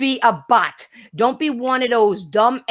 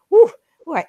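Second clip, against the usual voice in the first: usual speaking voice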